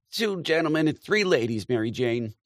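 high, loud calling voice; Aunt Kate calling out. A narrator's reading. Aunt Kate calling to Mary Jane, high and loud. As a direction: call across the room to Mary Jane. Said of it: "Aunt Kate crying".

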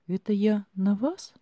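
dawning fright, voice lifting high; frightened question. A narrator's reading. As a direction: ask it scared of the answer. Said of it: "испуганно выдала я".

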